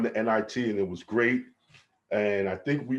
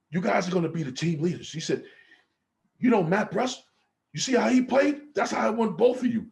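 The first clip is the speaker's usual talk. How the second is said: imitating strength coach; 10 semitones higher than usual. Strength coach telling players they're leaders, hyped. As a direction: hype them up like a strength coach.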